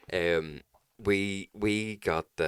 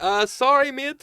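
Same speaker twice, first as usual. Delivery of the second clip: sheepish apology; 9 dB louder, slower than usual. Retold or imitated.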